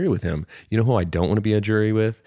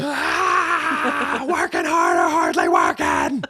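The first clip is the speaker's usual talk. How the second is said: mock shout; much slower than usual. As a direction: holler it like a goofy catchphrase.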